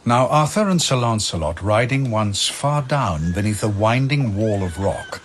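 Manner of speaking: ordinary delivery, nothing marked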